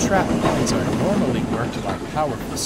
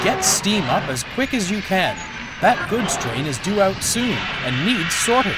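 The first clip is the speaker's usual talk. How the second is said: urgent yard manager; 8 semitones higher than usual. A narrator's reading. Yard manager giving urgent orders.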